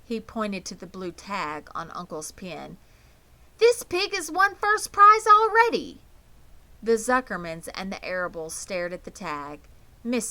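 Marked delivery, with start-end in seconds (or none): 3.6-6.0 put-on high, loud astonished boy; amazed boy. A narrator's reading. Avery tells the family about the rival pig's prize. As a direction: announce the shocking news.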